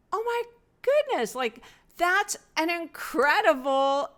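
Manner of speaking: high-pitched retold "oh my goodness" amazement; wonderstruck, starting very high and easing down; amazed. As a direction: relive the astonished thought, pitch jumping up. Retelling her amazed thought.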